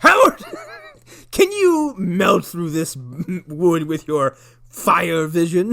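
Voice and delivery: high loud name, then slow halting plea; hammed-up manic cadence; Tim Robbins. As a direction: drag it out with dramatic breaks. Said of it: "Tim Robbins' cadence in Sunny".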